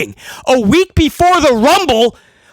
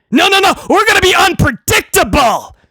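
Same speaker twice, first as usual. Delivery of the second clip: higher, dismissive boss; Helmsley; 5 semitones higher than usual. Retold or imitated. Boss brushing off a writer's objection, dismissive. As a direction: cut them off, insisting breezily. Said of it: "Helmsley sits down and goes".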